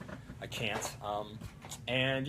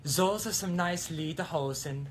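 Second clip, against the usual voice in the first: slow, higher mock German girl; 5 semitones higher than usual. Admiring a man's lederhosen in a mock German girl voice. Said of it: "German girl".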